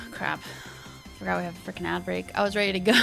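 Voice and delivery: ordinary talk, nothing marked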